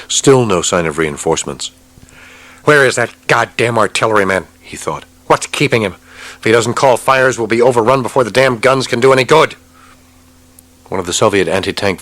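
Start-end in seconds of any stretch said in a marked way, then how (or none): 2.6-9.6 frustrated thoughts. Acted. Captain's angry inner thoughts.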